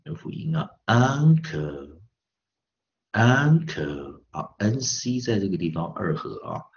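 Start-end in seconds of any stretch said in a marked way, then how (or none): 0.9-4.2 UNCLE very slowly, in two split syllables; word demo. Shown as a demonstration. Teacher sounding out vowel and consonant, slow word demo. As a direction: stretch "uncle" out for learners.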